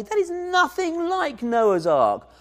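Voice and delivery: high, scornful, mocking a child's drawing; mocking laugh. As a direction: scoff at the child's picture. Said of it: "Do you laugh and say".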